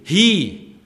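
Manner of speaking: He called out loud and high; stress on he. Real, not acted. Preacher stressing Christ alone is head, loudly punching He. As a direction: hit the word He hard and high. Emphasis here is on the word he.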